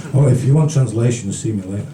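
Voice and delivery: usual speaking voice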